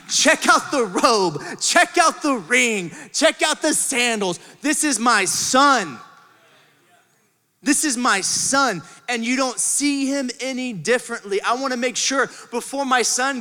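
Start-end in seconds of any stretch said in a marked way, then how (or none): 0.1-8.8 slow, proud presenting of the robe and ring; proud showing off. Acted. Father showing off his son to everyone, slow and proud. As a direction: show him off to the crowd.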